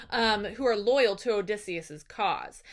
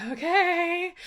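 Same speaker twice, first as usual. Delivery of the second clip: higher, drawn-out mock assent; hesitant agreement; 6 semitones higher than usual. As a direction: go along with it, pitched up and unconvinced. Telemachus doubtfully accepts the plan.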